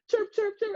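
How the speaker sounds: high bird chirping imitated; playful high bird chirps; high mock chirps. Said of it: "It's like".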